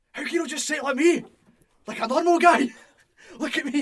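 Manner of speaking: high, very loud indignant impression; hammed-up, incredulous yelling; mock shouting. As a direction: shout it as an exasperated wrestler. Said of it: "you're shouting at".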